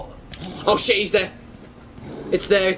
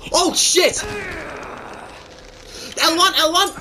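frantic alarm; 5 semitones higher than usual. Real, not acted. Attacked, frantic button call.

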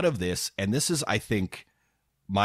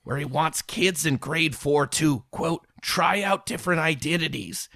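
mock outraged commenter, higher; 5 semitones higher than usual. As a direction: read it like a fuming commenter. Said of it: "Here's one".

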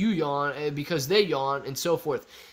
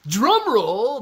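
drum roll announcement; 7 semitones higher, 8 dB louder than usual. Pretend. Reading chat line with announcer flair.

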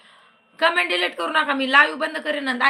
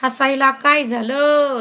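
louder, slower, stretched demand; loud slow repeat; 3 semitones higher, 6 dB louder, slower than usual. Real, not acted. Host demanding why the laughing viewer laughs, loud and slow. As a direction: drag the question out and push it.

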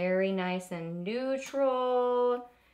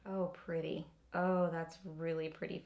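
whisper; 4 semitones lower, 7 dB quieter than usual. Real, not acted. Trying a lipstick shade.

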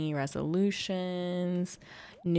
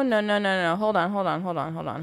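ordinary delivery

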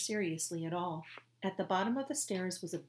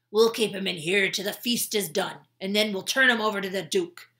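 gloating guard; 2 semitones higher, 11 dB louder than usual. Acted. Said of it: "said Squinty".

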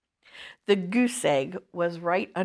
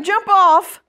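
bossy shout; 9 semitones higher, 8 dB louder than usual. Acted. Kid demanding turn.

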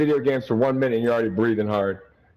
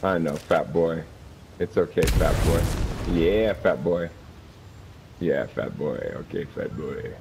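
softer and lower, fading out; fading taunt; 11 semitones lower, 6 dB quieter than usual. Pretend. Teasing the opponent, fading quiet taunt. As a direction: let the taunt trail away.

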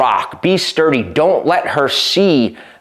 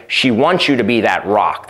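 usual speaking voice

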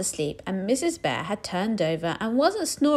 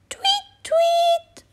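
very high, louder tweets; tweeting; 4 dB louder than usual. Acted. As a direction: chirp like a bird. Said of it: "went the birds".

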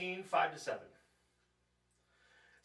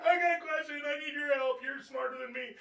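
higher, eager asking voice; pleading to senior pastor; 10 semitones higher than usual. Retold or imitated. Pastor calling his senior pastor for help, higher pleading. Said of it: "You call your senior pastor".

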